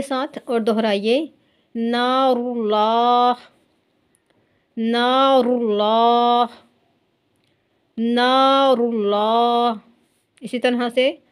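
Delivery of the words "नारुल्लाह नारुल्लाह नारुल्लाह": नारुल्लाह with a thick, dark ल, slow and drawn out; slow tajweed recitation; heavy lam. Shown as a demonstration. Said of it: "लाम है वो मोटा होगा".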